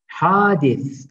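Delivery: Hadis with a lisped final th; Arabic hadith. Shown as a demonstration. Teacher modelling Hadis for the class, lisped th. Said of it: "peltek S sonda".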